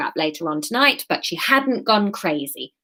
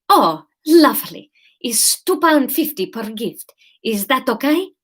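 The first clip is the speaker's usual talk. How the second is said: higher, relieved Romanian-sounding assistant; relieved assistant in a vague Eastern European accent; Romanian-ish accent; 5 semitones higher than usual. Acted. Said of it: "it is quite close to Romanian, actually, that accent".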